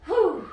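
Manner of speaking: high, loud breathy blow-out of relief; loud relieved whew. Real, not acted. Coach to class after the set, loud relieved whew. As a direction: let the air burst out after the last rep. Finishing a hard plank set.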